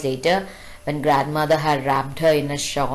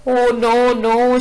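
put-on much higher and louder child's cry; mock scream; 7 semitones higher, 6 dB louder, slower than usual. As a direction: cry out in terror like a little girl. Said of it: "she screamed".

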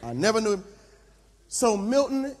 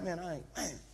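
muttered under the breath, much softer; inner monologue; 6 semitones lower, 11 dB quieter, much faster than usual. Acted. Pastor grumbling in his head about the cost, muttered low and soft. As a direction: grumble to yourself, barely aloud. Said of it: "I'm in my head. I'm like".

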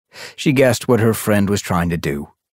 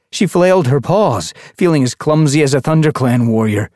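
louder, higher urgent reading; tense narration; 7 semitones higher, 5 dB louder than usual. A narrator's reading. Narrator drives the drowning panic, louder and tense. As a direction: read the panic building.

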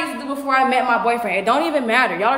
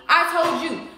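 usual speaking voice

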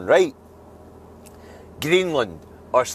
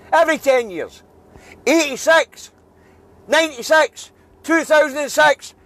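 higher, louder furious tirade; furious rant; 8 semitones higher, 4 dB louder than usual. Real, not acted. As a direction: hammer home the anger, pitch climbing. Furious rant about pandemic book.

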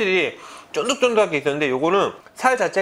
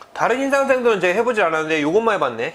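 ordinary delivery

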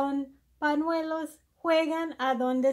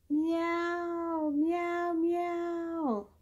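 meowing cat; 5 semitones higher, 3 dB quieter, much slower than usual. Acted. Imitating the cat for a toddler.

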